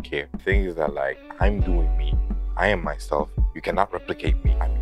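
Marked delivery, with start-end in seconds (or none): none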